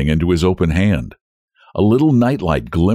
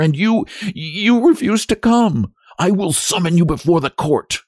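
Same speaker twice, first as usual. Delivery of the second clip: high, impassioned accusation; desperate wife turning to threats; indignant reproach; 9 semitones higher than usual. A narrator's reading. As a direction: reproach him hotly, pitched right up.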